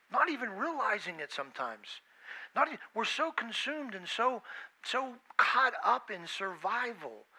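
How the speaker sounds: ordinary talk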